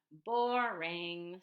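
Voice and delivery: loud, scornful verdict; dismissive. Acted. Lily rejects plain toast.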